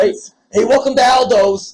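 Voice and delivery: put-on loud New York pizza guy accent; New York wise guy answering the phone. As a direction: greet the caller like a brash New York pizza man. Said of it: "authentic New York accent to answer their phones".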